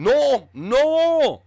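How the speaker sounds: loud, high mock French refusal; badly faked French accent, loud and high. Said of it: "I can't do the French accent either".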